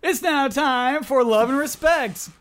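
high, slow, booming show announcer; segment announcer. Pretend. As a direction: announce it like a game show host.

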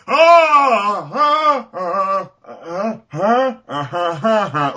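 high mock wails, fading; badly acted cries of distress; loud yelp tapering into uncertain ahs; imitated death cries. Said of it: "the noises that he's making".

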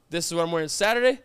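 rattling off quickly; quick checklist voice; fast listing. Retold or imitated. Quoting own packing, fast.

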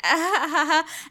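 high, forced mock laugh; fake laughing. Students on yoga mats staring at the ceiling, fake laughing. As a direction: imitate laughing with no real amusement. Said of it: "fake laughing".

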